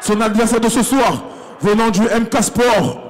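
big arena announcer voice; boxing ring announcer. Acted. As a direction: call it out to the whole hall. Ring announcer introduces fighters.

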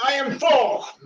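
yelling. Shown as a demonstration. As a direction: shout the affirmation. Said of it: "and then yell it".

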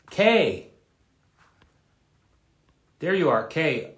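high, loud call by name. Real, not acted. Host spotting viewer Kay in the chat, calling out. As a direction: call out to someone you have just spotted.

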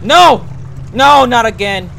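high, loud no of panic; half-joking panicked yell; loud cry of dread. Real, not acted. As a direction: shout it out as the nightmare returns. Bad trip scene in game.

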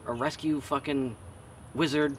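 ordinary talk, nothing marked

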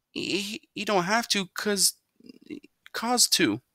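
high, halting stammer; stammering son. A narrator's reading. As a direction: stumble over the words, caught out.

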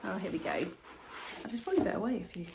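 ordinary talk, nothing marked

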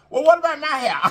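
high, laughing playful complaint; jealous mock question. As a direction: tease about being left out, laughing. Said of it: "I said".

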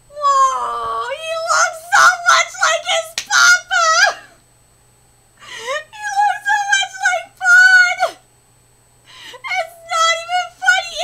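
high, loud crying shrieks; fangirl meltdown. Real, not acted. Fan melting down at a mini version of her favourite, overwhelmed.

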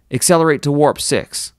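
captain's order. A narrator's reading.

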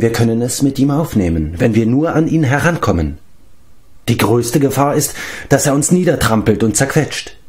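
usual speaking voice

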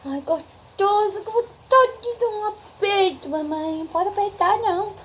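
high, slow squealing coo. Real, not acted. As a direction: squeal over how cute the puppy is. Baby talk to puppies.

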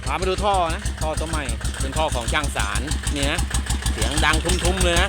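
talking loudly over a roaring pump. Real, not acted. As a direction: project over the noise, steady and loud.

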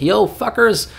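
high, brash, bossy rich boss voice; bossy rich sheikh. Retold or imitated. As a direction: bark orders like a spoiled billionaire. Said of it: "be like".

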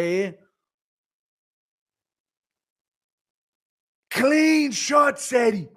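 high, drawn-out cornerman calls; Sus Connor. Acted. Dad watching his kids Eddie and Chad fight, high excited coaching. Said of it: "Can we get Sus Connor watching his kids Eddie and Chad fight".

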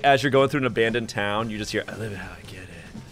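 ordinary talk

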